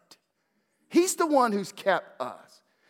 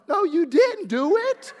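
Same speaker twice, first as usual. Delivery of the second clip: loud laughing mock protest, high; laughing retort; 10 semitones higher, 11 dB louder than usual. Dad teasing daughter that he did it, laughing loudly. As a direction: tease her through laughter. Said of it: "I'm sitting here going".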